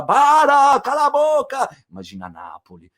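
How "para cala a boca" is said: put-on high, loud shrieking old neighbour; angry old woman. Old neighbour yelling at Andreuccio to shut up, high shout. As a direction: snap at the noisy stranger from a window. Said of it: "sai uma velha que fala".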